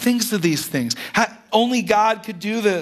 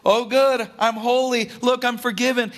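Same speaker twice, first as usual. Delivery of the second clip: mock smug boast; 6 semitones higher than usual. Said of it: "so he can say".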